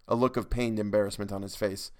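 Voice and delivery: plain reading voice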